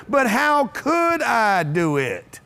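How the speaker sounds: stretched out, with weight on "could"; slow emphatic question. Shown as a demonstration. Speaker answering everybody's "you can't", slow and emphatic. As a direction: draw out each word to drive it home. Repeating the key phrase to teach.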